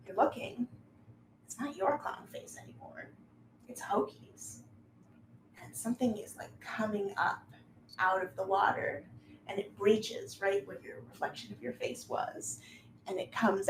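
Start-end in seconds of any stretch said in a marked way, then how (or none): none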